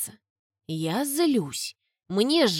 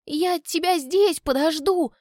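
high, slow squeak; squeaking girl; 8 semitones higher than usual. A narrator's reading. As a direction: squeak it out stubbornly. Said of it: "пищу".

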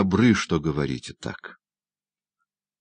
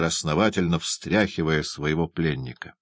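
plain reading voice

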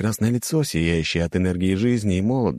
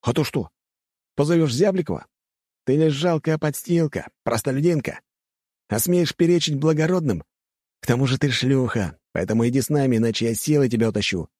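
sneering bully; 7 semitones higher than usual. A narrator's reading. Bully threatens her.

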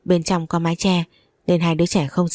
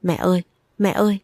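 lightly voiced child crying out for mom; a hint of a sobbing boy; mild tearful plea. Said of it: "bất chợt òa khóc lên nức nở".

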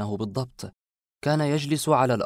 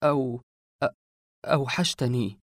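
stammered, halting start on the words; trembling voice. A narrator's reading. Narrator finally greets the long-missed man, voice trembling. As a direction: falter twice before getting the words out. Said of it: "نطقت بصوت مرتجف".

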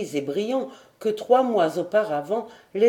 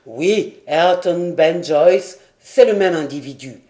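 impatient confirmation; 3 semitones lower, 6 dB louder than usual. A narrator's reading. Lord telling sailor both names are one man, impatient. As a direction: hammer out the names. Glenarvan insists on identity.

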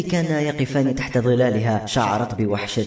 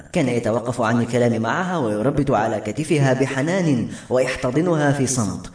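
usual speaking voice

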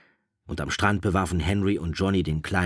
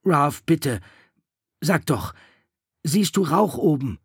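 higher, louder urgent plea; pleading; 10 semitones higher, 7 dB louder than usual. A narrator's reading. Simon anxiously begging Ralph to say whether there is smoke. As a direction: beg him for an answer.

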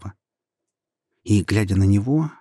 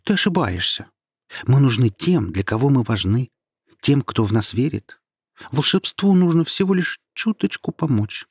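passionate; 2 semitones higher than usual. Acted. Star defiantly rejects the voice.

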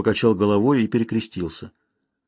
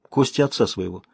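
ordinary delivery, nothing marked

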